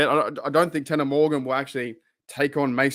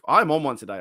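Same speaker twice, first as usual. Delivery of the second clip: ordinary talk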